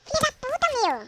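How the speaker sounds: high, loud curse; angry curse. Real, not acted. Player curses the mob that hit him. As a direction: spit the swear out.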